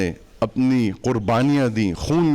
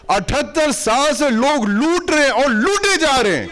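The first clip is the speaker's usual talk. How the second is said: impassioned tirade; 13 semitones higher than usual. Real, not acted. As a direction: cry it out to the crowd at the top of your range. Fiery rally speech against plunderers. (جوشیلی، بلند آواز میں تقریر)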